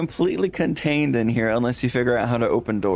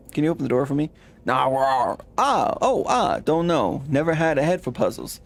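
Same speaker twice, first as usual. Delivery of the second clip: lightly voiced Western cowboy accent; a hint of a Western old-timer; cowboy drawl; 3 semitones higher than usual. Gabby answers the dinosaur in a mild cowboy accent. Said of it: "Gabby doesn't talk like that".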